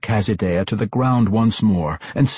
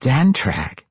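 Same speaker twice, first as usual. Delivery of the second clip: higher-pitched questioning echo; startled echo; 6 semitones higher than usual. A narrator's reading. Matron hears her dead brother's name, echoing it. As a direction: echo the name DANTRAG back, a little less forcefully. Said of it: "Quenthel Behenry echoed".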